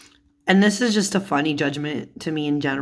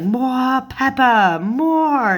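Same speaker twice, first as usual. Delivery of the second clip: high, loud, drawn-out retold scream; mock scream; 8 semitones higher, 4 dB louder, much slower than usual. Cook screaming for pepper in the scene, mock scream. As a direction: screech it slowly, imitating a hysterical cook. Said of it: "she's, like, screaming it".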